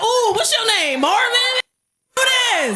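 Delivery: high, drawn-out coy cooing; hammed-up flirting. Woman flirting with the seatbelt hugging her, high and coy. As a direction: coo it like meeting an admirer.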